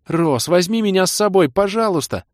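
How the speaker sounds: high, loud begging; eager pleading. Acted. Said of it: "взвилась боевая волшебница".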